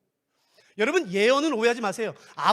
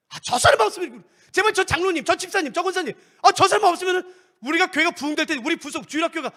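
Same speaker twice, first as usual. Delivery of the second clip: higher, louder frustrated wish; a church member complaining about elders, higher and louder; exasperated complaint; 7 semitones higher, 6 dB louder than usual. Acted. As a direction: sigh it out, fed up with them. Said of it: "acted grumbling church member".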